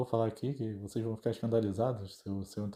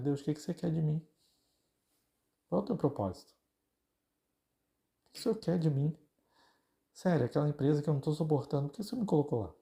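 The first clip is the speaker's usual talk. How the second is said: earnest questioning prayer; 5 semitones higher than usual. Retold or imitated. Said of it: "começar a perguntar pra Deus".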